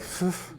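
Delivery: mock exasperated puff of breath; exasperated sigh. Speaker retelling their dismay at the old man's plan, exasperated sigh. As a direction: sigh as if worn down by it.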